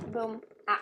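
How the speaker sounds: ordinary talk, nothing marked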